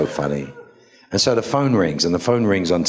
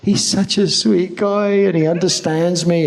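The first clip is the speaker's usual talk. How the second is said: mock Jody swooning, much higher and louder; smitten Jody; 13 semitones higher, 4 dB louder than usual. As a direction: gush over the sweet guy. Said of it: "She's like".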